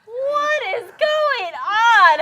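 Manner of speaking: loud, high, drawn-out cry; bewildered outcry. Acted. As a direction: shout it slowly in disbelief. Player cries out at the clone.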